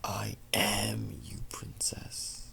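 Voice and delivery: breathy menacing whisper; hissing shadow self; eerie shadow whisper. Acted. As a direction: whisper like a dark inner voice. Shadow voice taunting Luna.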